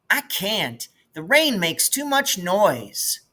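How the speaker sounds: put-on a small girl peeking out of bed; little sister's voice. Little sister Maybelle wakes and asks.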